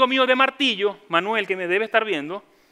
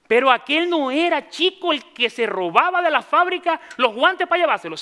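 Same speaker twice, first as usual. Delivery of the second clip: voiced gossip; 5 semitones higher, 4 dB louder than usual.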